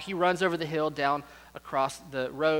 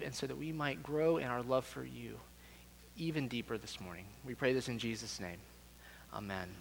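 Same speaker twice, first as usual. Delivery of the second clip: soft, low-voiced prayer; 4 semitones lower, 6 dB quieter than usual. Real, not acted. Pastor praying with the congregation before the sermon, hushed prayer. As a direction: speak reverently to God, softening towards the Amen.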